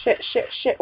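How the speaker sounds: ordinary delivery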